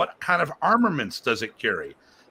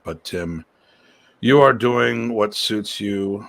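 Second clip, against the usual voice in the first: normal talking voice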